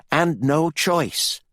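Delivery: high, slow, emphatic finish; urgent resolve. A narrator's reading. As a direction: stretch it out, firmly resolved. Candy refuses during the struggle.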